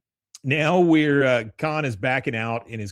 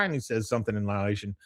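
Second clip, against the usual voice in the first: normal talking voice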